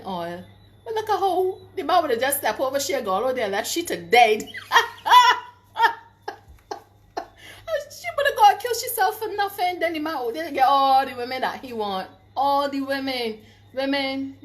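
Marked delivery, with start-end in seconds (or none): 4.5-5.5 loud high burst of laughter. Real, not acted. Vlogger laughing at the woman's foolishness. 7.9-9.8 high, fast, laughing it out; laughing disbelief. Real, not acted. Vlogger laughing at the woman's foolish act.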